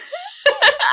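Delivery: high, loud laugh close to crying; loud high laugh, half crying; laugh with tears. Real, not acted. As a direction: laugh that almost breaks into a sob. Laughing at her own background.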